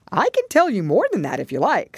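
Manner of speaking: high, loud creature offer; Gryphon. Acted.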